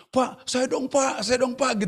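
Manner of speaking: high, eager begging, imitated; mock pleading. Employees begging the boss for a prize, imitated eager pleading. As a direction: imitate a clamour of people asking.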